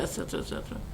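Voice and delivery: breathy whisper, tossed off; whispered et cetera; whispered aside. Real, not acted. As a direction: drop to a whisper for the list's tail.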